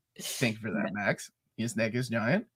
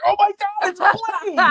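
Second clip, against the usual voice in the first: squealing high and loud with joy; a real fan yelp at the hero's entrance; excited shriek; 4 dB louder than usual. Real, not acted. Fan yelping at Blade's entrance, excited shriek. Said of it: "I think I clapped".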